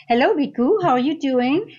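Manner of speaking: high, loud cheerful hello; excited greeting. Real, not acted. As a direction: call out a bright greeting. Calling out greeting to Viku.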